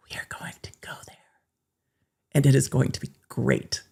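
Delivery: whisper. Acted. Whispered threat.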